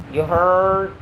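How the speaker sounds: drawn-out Southern "heard"; lightly hammed Southern accent. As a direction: put a Southern twang on it. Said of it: "southern accent you ever heard?".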